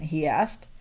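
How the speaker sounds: usual speaking voice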